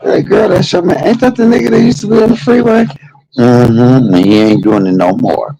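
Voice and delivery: put-on high-pitched nosy girlfriends trading gossip; gossiping onlookers. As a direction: play two women gossiping about a passing man.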